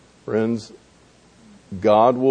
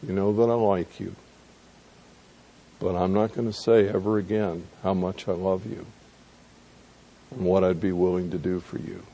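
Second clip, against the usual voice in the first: soft, subdued admission; humbled reply; 6 dB quieter, faster than usual. Acted. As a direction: answer quietly, chastened and careful. Humbled Peter answers Jesus in retelling.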